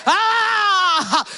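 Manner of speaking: long high wail; labor cry. Retold or imitated. Telling the congregation how she cried in labor, imitated wail. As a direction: cry out in one drawn-out "ah". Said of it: "I will do like this".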